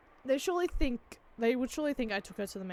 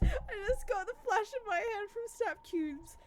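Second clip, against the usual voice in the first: normal talking voice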